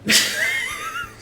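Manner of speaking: very high wheezing laugh; squealing laugh. Real, not acted. Streamer cracking up again, high squealing laugh.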